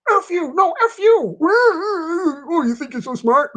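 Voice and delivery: high, loud playful yelling; hammed-up bickering with a laugh breaking in; mock shouting match. Bickering with his boss under pressure, playful mock yelling.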